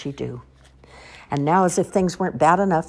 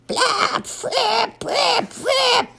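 very high, loud trumpeting; elephant noise; 4 dB louder, much slower than usual. Acted. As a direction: toot like a clumsy elephant. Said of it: "I'm trying to speak elephant".

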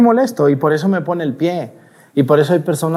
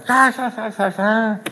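high, put-on villain cackle; gloating fake ha-ha of the devil; devil's mocking laugh; 8 semitones higher, 4 dB quieter, slower than usual. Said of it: "Para que el diablo ... voltear a Dios y decirle".

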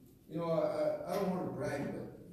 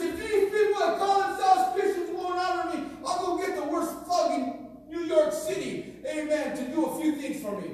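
God speaking; 6 semitones higher than usual. Acted. Preacher voicing God's warning to unfaithful Christians, high commanding shout. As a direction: boom it out like God laying down the law. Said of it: "he says".

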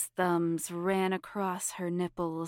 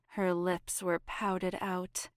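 ordinary delivery, nothing marked

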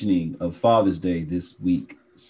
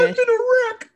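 squeaky joke; 5 dB louder, faster than usual. Pretend. Joking outburst.

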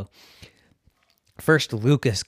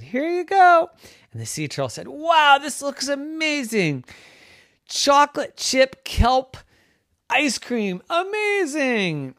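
high, slow amazed voice; delighted turtle; 7 dB louder than usual. Acted. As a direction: marvel at the treat. Turtle thrilled by ice cream.

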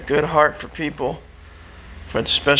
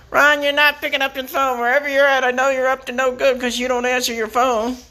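high-pitched nagging mom impression; mock a scolding mom in a much higher voice; overzealous mother; 10 semitones higher than usual. Mom phoning to scold her son, high overbearing nag. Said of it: "how overzealous mothers can be".

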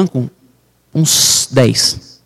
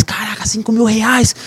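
"Caraca, cinco mil reais" higher, drawn-out, marvelling; amazed; 6 semitones higher, 4 dB quieter, slower than usual. Retold or imitated. Reliving his amazement at the sale to his friend, higher and drawn out. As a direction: gasp it out in happy disbelief, slowly.

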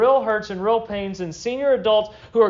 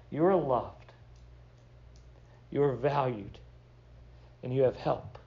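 soft and deliberate; 8 semitones lower, 9 dB quieter than usual. Real, not acted. Pastor to his church, soft and deliberate. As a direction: lay each line down gently for the church. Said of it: "I want you to hear very clearly".

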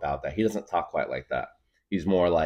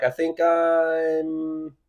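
strained voice; 9 semitones higher, 4 dB louder, much slower than usual. Retold or imitated. Racing heart, straining voice.

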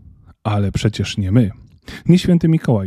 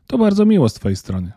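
dignified; 9 semitones higher than usual. Acted. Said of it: "powiedział z godnością".

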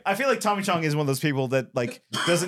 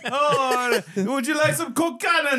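mock Irish shout; 10 semitones higher, slower than usual. Silly accent riff.

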